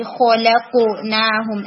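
very slow خلقناہم, خ and ق heavy; drawn-out خلقناہم with thick خ and ق; teacher shows heaviness in خلقناہم; heavy letter demo. Shown as a demonstration. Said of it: "خو کے اندر heaviness ویک ہو رہی تھی".

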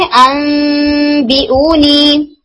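अनबिऊनी recited slowly with nasal ghunna on the मीम; Quranic recitation. Shown as a demonstration. Teacher recites example word अनबिऊनी for students, in slow recitation. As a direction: chant अनबिऊनी, holding the नून turned मीम. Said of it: "इसे आप क्या करते हैं".

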